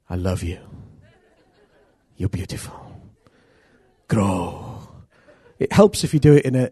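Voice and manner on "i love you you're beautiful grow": low, soft, doting murmur to a little tree; tender cooing to plants. Pretend. Preacher fondly coaxing his potted tree, tender and low. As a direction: coo lovingly to the Christmas tree.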